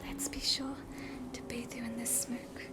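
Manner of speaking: usual speaking voice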